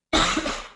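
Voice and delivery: sudden choking cough; coughing up water. Real, not acted. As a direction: cough as water goes down wrong. Water down the wrong pipe.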